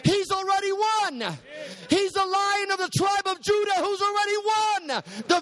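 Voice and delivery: high, ringing and sustained; preaching climax. Acted. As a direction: lift the room, hold the top note. Preacher builds to full peak.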